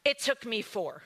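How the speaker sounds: ordinary talk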